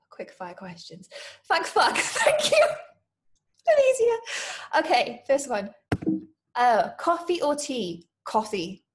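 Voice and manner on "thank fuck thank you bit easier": high, loud, laughing thanks; laughing relief. Acted. Nervous applicant welcomes easier questions, loud laughing relief.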